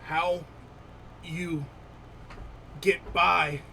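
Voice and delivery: halting goblin speech, word by word; slow, piecemeal foreign-tongue delivery; broken Common. Acted. Wounded goblin questioning the party in halting broken Common. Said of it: "again in Broken Common".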